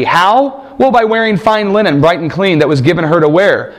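usual speaking voice

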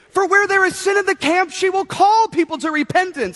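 high, ringing preacher's declaration; mock prophetic proclamation. Proud dad announcing daughter as prophet, mock-grand. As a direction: proclaim it like an Old Testament herald.

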